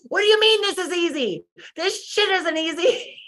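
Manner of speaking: high, indignant and half-laughing; exasperated outburst. Retold or imitated. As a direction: protest with comic frustration, breaking into a laugh. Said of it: "and I'm like".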